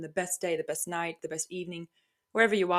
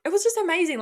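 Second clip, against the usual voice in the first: high, loud, gushing rush; thrilled burst of delight; excited; 10 semitones higher, 5 dB louder, faster than usual. Real, not acted. Gushing over the run.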